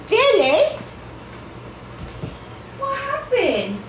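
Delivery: high sing-song pet voice. Real, not acted. Cooing to the cat.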